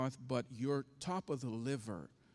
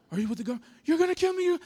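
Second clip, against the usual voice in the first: terrified; 14 semitones higher, 11 dB louder, much faster than usual. Retold or imitated. Terrified wife suspects the government.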